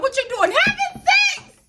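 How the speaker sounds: loud, high yell, stretched at the end; reenacted frantic blurt. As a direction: yell it out as if mid-beating, hammed-up. Reenacts blurting it out while beaten.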